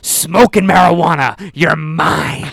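loud, menacing cop impression; over-the-top cop. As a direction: bust them like a hammy cop. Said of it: "exaggerating the way that he talked".

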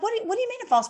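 high, soft confused granny voice; lightly hammed-up confused old lady; puzzled grandma. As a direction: sound baffled, like a grandma. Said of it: "They're gonna be like".

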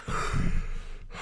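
breathy, wordless mock yawn; put-on yawning sigh; fake yawn. Shown as a demonstration. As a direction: exhale as if yawning to avoid a greeting. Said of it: "I fake a yawn".

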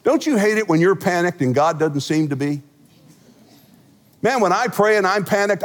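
ordinary talk

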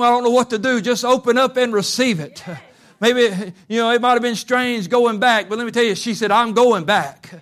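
loud, high, rousing sermon voice; preaching at full force. Acted. Preacher urging the congregation to go back, loud and rousing.

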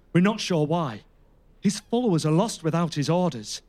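ordinary talk

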